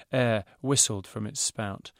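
ordinary delivery

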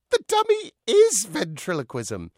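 high, loud declaration; emphatic protest. Acted. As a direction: proclaim it loudly, leaning on IS. Dummy's protest against Joe's verdict.

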